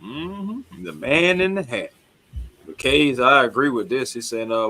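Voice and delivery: ordinary delivery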